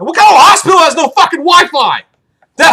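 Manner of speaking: loud, high indignant outburst; loud, high outrage at a hospital without Wi-Fi; outraged. Real, not acted. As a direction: blurt it out, fed up.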